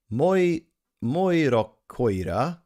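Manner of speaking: "Moirokoira" attempted slowly, with a false start; butchered Finnish pronunciation. Real, not acted. Streamer trying Laura's Finnish word, butchered pronunciation. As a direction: sound out "Moirokoira" haltingly, as a non-Finn. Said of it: "butchering that".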